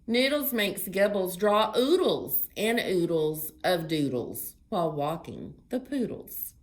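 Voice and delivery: loud, lively picture-book read-aloud; reading to children. Acted. Librarian reading a story to children, lively. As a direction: read it big and bright for little ones.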